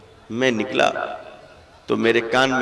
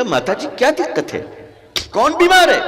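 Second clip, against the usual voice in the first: urgent concern; 13 semitones higher, 4 dB louder than usual. Retold or imitated. Retold asking old woman.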